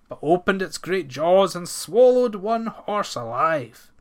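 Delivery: ordinary delivery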